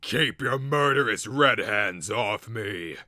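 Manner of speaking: furious slow full-volume roar. A narrator's reading. Troll shoving away outstretched arms, roaring. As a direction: roar it out, loud and drawn out. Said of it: "The Clodder Trog roared".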